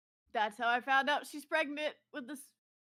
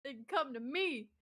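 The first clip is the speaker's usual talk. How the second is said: raised voice calling out to the room; loud challenge; 3 semitones higher, 7 dB louder than usual. Acted. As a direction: call it out to everyone. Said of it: "I stand up and said".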